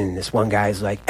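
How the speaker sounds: normal talking voice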